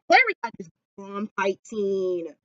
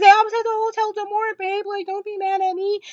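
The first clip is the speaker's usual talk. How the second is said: put-on sheepish boyfriend; 11 semitones higher than usual. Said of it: "he says".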